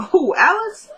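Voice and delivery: high, swooping mock disbelief; incredulous questioning. Woman to her partner about his ex, high incredulous question. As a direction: pitch the name way up, as if incredulous.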